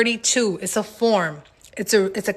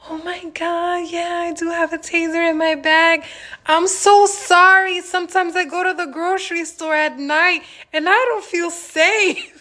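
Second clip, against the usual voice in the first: high-pitched, sweetly contrite put-on voice; acted apologetic pleading; 8 semitones higher than usual. Woman explaining her taser to courthouse security, high-pitched pleading apology. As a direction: play up a high, innocent apology.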